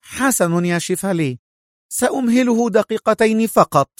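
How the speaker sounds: high, vexed woman's voice; high-pitched irritated concession; angry. Acted. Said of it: "قالت سونيا في غضب".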